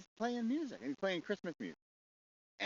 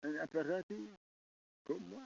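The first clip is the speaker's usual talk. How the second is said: hammed-up French accent, charming and breezy. French soldier offering an apéritif, hammed-up French accent. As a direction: play a flirty Frenchman, lightly mocked. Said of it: "French guy".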